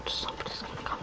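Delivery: very soft, voiceless whisper. Pretend. Player warns that somebody is coming, whispered. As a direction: whisper it as a hushed warning.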